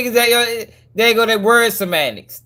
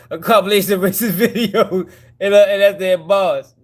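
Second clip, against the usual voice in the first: laughing through the words; a laugh breaking into the phrase; 2 semitones higher than usual. Real, not acted. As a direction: talk while cracking up. Cracking up at the videos.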